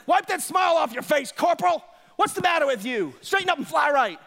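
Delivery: a mock military yell in your face; drill sergeant. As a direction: bark orders like a drill instructor. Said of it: "the military guy standing there".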